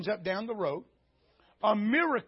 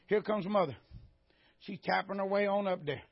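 usual speaking voice

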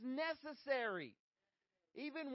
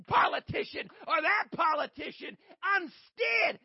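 rising impassioned oratory; 6 semitones higher, 9 dB louder than usual. Acted. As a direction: build the sermon to a shouting peak. Preaching at full force.

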